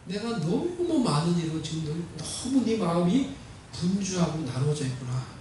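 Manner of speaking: very soft, low, hushed call; gently chide, almost under the breath; gentle reproof. Retold or imitated. Jesus gently reproving busy Martha, hushed and low. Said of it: "완곡하게 그 요구를 거절하면서".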